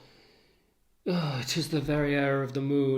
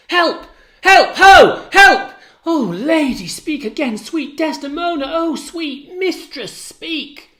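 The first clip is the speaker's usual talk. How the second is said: high desperate screams; screaming for help; 14 semitones higher, 12 dB louder than usual. Acted. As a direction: shriek for help.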